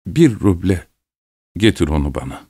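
lightly voiced old peasant, kept low; a hint of a gruff old villager giving an order. A narrator's reading. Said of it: "Yaşlı köylü".

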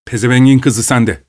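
a touch of a husband's anger at his wife; angry cursing. A narrator's reading.

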